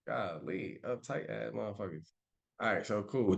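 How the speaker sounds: ordinary talk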